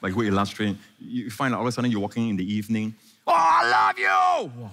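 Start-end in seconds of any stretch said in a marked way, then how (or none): 3.3-4.7 high, long-drawn comic shout; mock lovesick yell, stretched out; yelling lover. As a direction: holler it slowly across the park. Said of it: "Lovers don't yell".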